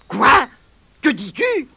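sudden alarm; alarmed boy. Acted.

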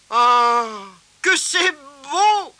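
high, loud, slow cry of wonder; delighted cry. Acted. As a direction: gasp with delight at the baubles. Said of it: "s'écria Tirondin".

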